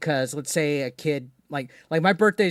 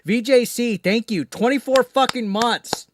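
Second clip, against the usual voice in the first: loud, high hype for a fan; excited shout-out; 8 semitones higher, 6 dB louder than usual. Real, not acted. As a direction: hype up the member's milestone. Celebrating a member's anniversary.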